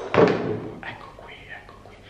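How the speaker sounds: hushed whisper, not to wake him. Real, not acted. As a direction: whisper so nobody wakes. Sneaking up on sleeping friend.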